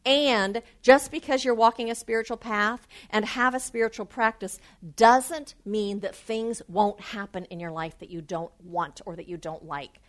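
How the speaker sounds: ordinary talk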